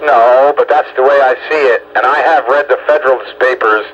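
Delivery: ordinary delivery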